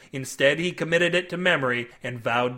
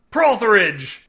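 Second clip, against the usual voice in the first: loud, raised captain's summons; bellowed; 5 semitones higher, 7 dB louder, slower than usual. A narrator's reading. Captain Syrup summoning a crewman, bellowing. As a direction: bellow the name across deck. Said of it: "Captain Syrup bellowed".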